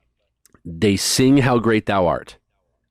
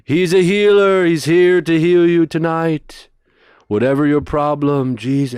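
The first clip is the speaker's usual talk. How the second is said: imitated healing preacher; 5 semitones higher, 4 dB louder than usual. Preacher uncle promising healing to the crowd. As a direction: build the room toward a healing. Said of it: "and he goes".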